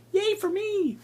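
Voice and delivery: a very high, slow squeal of yay; mock cheer. Real, not acted. YouTuber sarcastically cheering his own birthday in a high squeal. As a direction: celebrate yourself with ironic glee.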